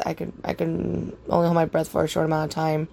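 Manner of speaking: normal talking voice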